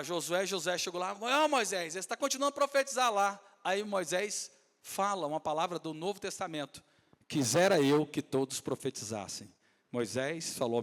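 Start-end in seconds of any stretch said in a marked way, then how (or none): 1.1-3.4 Joshua calling out. Acted. 7.3-9.5 solemn Moses. Acted.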